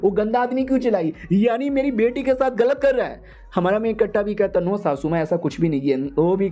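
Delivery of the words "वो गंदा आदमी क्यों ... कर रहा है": high-pitched, stammering accusation; hammed-up indignant in-law; outraged mother-in-law. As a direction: confront the culprit, pitch climbing. Mother-in-law confronts son-in-law.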